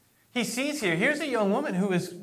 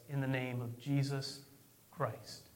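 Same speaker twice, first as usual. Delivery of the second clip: quiet solemn command; 6 semitones lower, 12 dB quieter than usual. Retold or imitated. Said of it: "he says".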